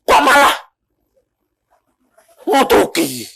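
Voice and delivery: high, loud mock outrage; angry retort. In-law retorting to the preacher, mock outraged. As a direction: act out an offended in-law firing back. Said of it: "besan saya marah apa enggak? Marah".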